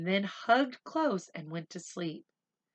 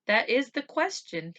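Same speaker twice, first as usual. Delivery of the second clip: booming doctor; 7 semitones higher, 4 dB louder than usual. Acted. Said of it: "he boomed".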